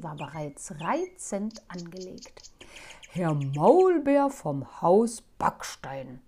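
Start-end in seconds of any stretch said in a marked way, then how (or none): 3.1-6.2 slow, relishing every word; proud, slow self-naming; savoring each word. Acted. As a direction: taste each word of the new title. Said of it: "Jedes Wort genoss er so richtig".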